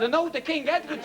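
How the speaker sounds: the German tourist has the last word; mock German accent. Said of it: "the German".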